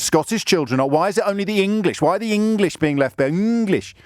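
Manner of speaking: high-pitched mock-outraged rant; hammed-up, high-pitched tabloid-style indignation; demonstrating outrage-bait. Shown as a demonstration. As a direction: wail it indignantly, as if stirring up listeners' outrage. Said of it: "I would today do the show like this".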